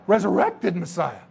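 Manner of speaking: usual speaking voice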